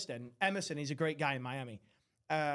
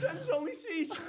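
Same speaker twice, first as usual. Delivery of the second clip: very high, hammed-up voice; mock high voice breaking into laughter; laughing catchphrase; 12 semitones higher, 3 dB louder than usual. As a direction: squeal the running joke while cracking up. Repeats the catchphrase as joke.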